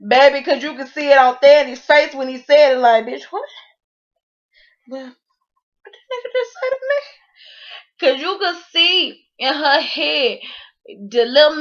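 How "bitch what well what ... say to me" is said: stunned disbelief. Retold or imitated. Voicing the stunned side chick.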